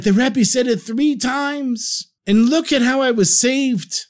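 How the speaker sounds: high, slow, amazed; excited retelling. A narrator's reading. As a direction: let the wonder of the miracle ring out.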